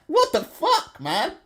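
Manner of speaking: a sudden high outburst dissolving into laughter; real laugh at something absurd; laughing disbelief. Real, not acted. Host cracking up at a tweet he just read to viewers, laughing.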